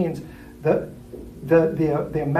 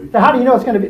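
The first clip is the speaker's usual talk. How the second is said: higher, louder doubting question; skeptical challenge; 7 semitones higher, 7 dB louder, much faster than usual. Acted. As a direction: grill the optimist. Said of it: "That's the C".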